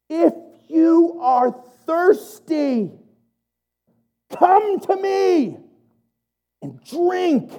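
high, slow, ringing proclamation; Jesus crying out to the crowd, high and slow; proclaiming shout. Retold or imitated. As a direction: call it out to the whole crowd, slowly. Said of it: "Imagine Jesus shouting at the top of his lungs".

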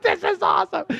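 thrilled by it all; gleeful. Retold or imitated. Dancing all night at the show, high delighted exclaim. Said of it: "I was like".

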